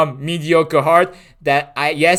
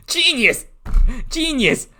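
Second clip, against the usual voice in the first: high exclaiming; 9 semitones higher, slower than usual. Real, not acted. Mocking own plan.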